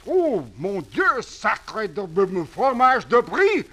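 put-on panicked French accent; frantic French dandy rushing off.

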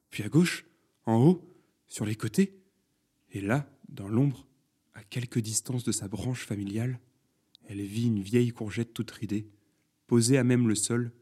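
usual speaking voice

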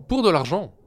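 high, sing-song naive reply; simple farmhand voice. Acted. Eldest farmhand to the judge, high naive reply. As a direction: chirp it out innocently. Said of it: "il répondit".

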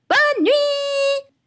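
put-on very high, drawn-out children's call; gleeful goodnight. A narrator's reading. Children jump into bed and call goodnight, gleeful.

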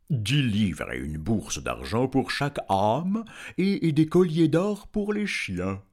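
slow dragon voice, climbing high at the end; anxious dragon. A narrator's reading. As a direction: make a nervous, drawn-out offer. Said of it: "avec anxiété". (dragon anxieux, voix lente qui monte)

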